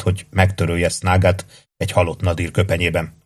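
ordinary delivery

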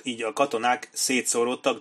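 usual speaking voice

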